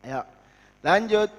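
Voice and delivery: ordinary talk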